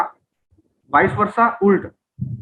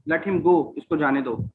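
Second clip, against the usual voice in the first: usual speaking voice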